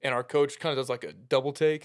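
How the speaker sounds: usual speaking voice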